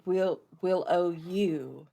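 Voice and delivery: ordinary talk, nothing marked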